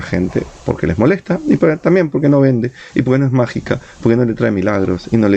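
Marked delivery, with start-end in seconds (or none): none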